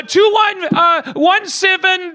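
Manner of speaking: high, halting and slow; stammering out numbers in panic; panicked caller. Acted. Panicked caller gives address.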